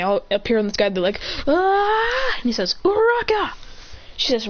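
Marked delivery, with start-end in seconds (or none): 1.5-2.3 high startled "ah" scream. Acted. Team shrieking as they suddenly appear in the sky. 2.8-3.5 high, sharp call of a name; urgent call. Acted. Calling Uraraka mid-fall to make them float, urgently.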